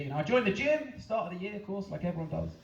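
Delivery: ordinary delivery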